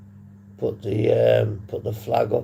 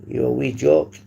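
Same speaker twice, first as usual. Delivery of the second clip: mock Scottish; 4 semitones higher, 6 dB louder than usual. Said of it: "You from Scotland, mate?".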